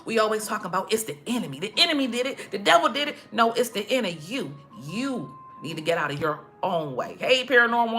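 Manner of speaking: ordinary delivery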